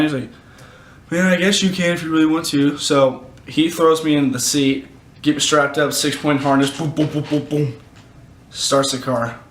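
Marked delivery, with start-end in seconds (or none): 1.1-2.8 dad. Retold or imitated. Dad's reply voiced in the story. 6.8-7.8 rapid popping "boom" sounds; quick run of mouth-made thumps; imitated harness noises. Strapped into six-point harness, imitated thumps.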